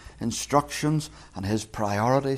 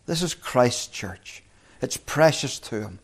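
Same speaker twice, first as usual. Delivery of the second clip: ordinary talk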